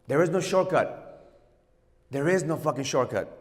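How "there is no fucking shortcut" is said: ordinary talk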